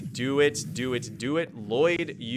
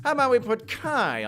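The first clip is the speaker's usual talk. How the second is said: lightly hammed-up high voice; a playful sing-song pick; silly high voice; 10 semitones higher, 3 dB louder than usual. Streamer picking Kai for the team in a silly high voice.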